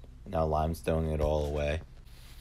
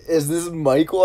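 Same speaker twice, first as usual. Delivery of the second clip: high, loud imitation of a caller; hammed-up caller question; mock caller; 11 semitones higher, 9 dB louder than usual. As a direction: mimic the caller in a raised, excited voice. Acts out the wrong-number caller.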